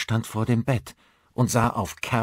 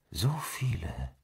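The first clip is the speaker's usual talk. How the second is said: slow, breathy whispered echo; dreamy whisper; 5 dB quieter than usual. A narrator's reading. Caro dreams aloud on the raft, whispering. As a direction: murmur it in a dream.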